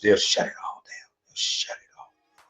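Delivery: hushed, unvoiced whisper. Telling how the women went silent, whispered. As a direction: drop to a whisper for effect.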